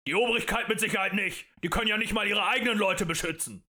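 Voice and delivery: grumpy man's loud retort; gruff craftsman. A narrator's reading. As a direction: call back angrily from the crowd. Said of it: "Wieder der bärbeißige Kerl".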